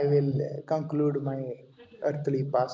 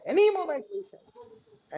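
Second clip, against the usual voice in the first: "Any moment we shall die" pushed much higher, peaking on "any"; "Any moment we shall die" repeated, far higher and more urgent; climactic heavy voice; 12 semitones higher than usual. Retold or imitated. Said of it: "a very heavy voice".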